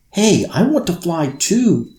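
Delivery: high, loud protest; shouting. Acted. Girl to the Sandman, loud and high, demanding a turn. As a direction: cry out, wanting a turn too. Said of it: "Thompson shouted".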